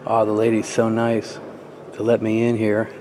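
normal talking voice

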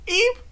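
high squeaky eep of fright; startled eep. Acted. Rarity squeaks in fright at the storm. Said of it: "cried out".

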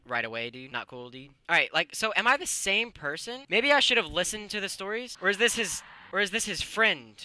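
usual speaking voice

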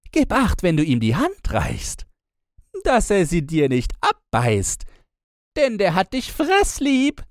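very high, loud teasing sister voice; shrill up high to tease her; mocking sister. A narrator's reading. Older sister mocking the bride about her bear groom. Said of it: "Diese spöttelten".

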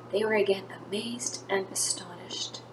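soft, awed storyteller whisper. A narrator's reading. Teacher whispering the crowd's amazement to children. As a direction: hush it like a secret wonder.

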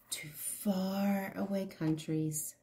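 soft unvoiced whisper; whispered echo. A narrator's reading. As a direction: breathe it like a secret echo.

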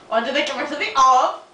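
put-on Russian accent. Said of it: "Why do you have Russian accent".

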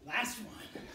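pumped-up workout coach; aerobics instructor. Real, not acted. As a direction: push the class through the reps. Leads the high knees exercise.

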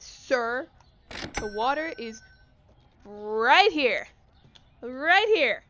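loud mocking emphasis; exasperated loud sarcasm; sarcastic loud retort. Real, not acted. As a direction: bark it at a customer. Sarcastic rant at customer.

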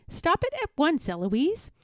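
high, cheeky echo; mimicking the tutor. A narrator's reading. Eloise copying her madder tutor, high and cheeky. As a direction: parrot the order, name lilting up. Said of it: "And then I say".

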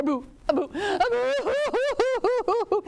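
high, hammed-up boo-hoo; wavering comic wail, climbing high; mock crying. Refused snake cries under the house, high mock bawling. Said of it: "he cried and he cried".

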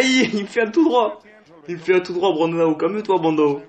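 stop-start excited cry; excited yelling. Real, not acted. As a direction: shout at the screen in excitement. Excited play-by-play commentary.

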